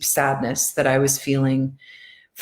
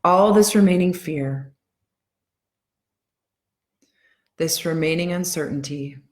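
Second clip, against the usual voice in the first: guided affirmations. Acted. Tapping coach guiding her followers through calming affirmations. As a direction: ease them into calm, one line at a time.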